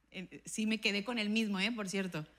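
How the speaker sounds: usual speaking voice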